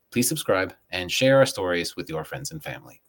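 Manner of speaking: ordinary delivery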